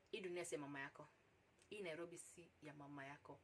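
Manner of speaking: low and very soft, resigned saying; quiet, stunned. Real, not acted. Storyteller left stunned by his proposition, quiet. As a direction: murmur it low, letting it sink in. Stunned realisation, then repeated louder.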